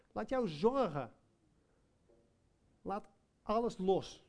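slow, rising sermon call; preaching. Acted. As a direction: build each "laat jouw" as a command to let go.

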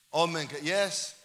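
ordinary talk, nothing marked

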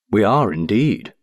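usual speaking voice

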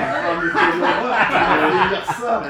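loud laughing exclamation. Real, not acted. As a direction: laugh through the words, slowly. Mocks the hard-to-read text.

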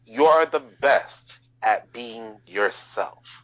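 each word slow and weighted; slow emphasis. Real, not acted. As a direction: lay it down slowly for emphasis. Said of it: "repeat that one more time".

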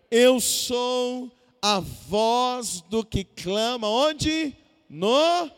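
slow, low, weighty Bible reading; stretched phrases falling deep at the end; scripture declamation. Acted. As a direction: proclaim the verse word by word with long held vowels. Reads scripture slowly aloud to congregation.

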